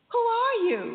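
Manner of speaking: high, loud challenge; calling out. Retold or imitated. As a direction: call the question out loud and high. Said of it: "So I said to him".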